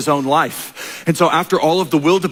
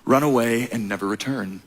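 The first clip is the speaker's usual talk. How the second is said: lower, softer sly villain voice; 5 semitones lower, 4 dB quieter than usual. Retold or imitated. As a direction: croon it low like a scheming uncle. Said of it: "He says".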